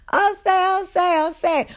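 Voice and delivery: inmates calling out. Retold or imitated. Inmates calling to the guard, high mock shout. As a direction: squeal it out like a rowdy inmate.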